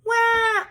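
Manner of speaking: put-on high, loud baby wail. A narrator's reading. Baby crying again after biting her toe, high and loud. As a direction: wail like a newborn. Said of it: "Jackie Mackie cried".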